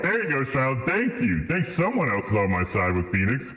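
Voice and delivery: normal talking voice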